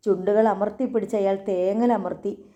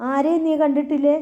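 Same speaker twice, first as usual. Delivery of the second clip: sharper, higher, louder demand; harsh interrogation; 6 semitones higher, 4 dB louder than usual. A narrator's reading. Thampi grilling the frightened girl, sharp and loud. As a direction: press her hard for an answer.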